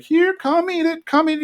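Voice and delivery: high, sing-song coaxing of a pet; high coaxing Bill voice. Retold or imitated. Bill feeding his iguana at the table, high coaxing voice.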